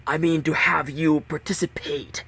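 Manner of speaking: slow, cold masked captain; implacable villain. Acted. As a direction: lay out the game coldly. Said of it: "The voice continued, implacable".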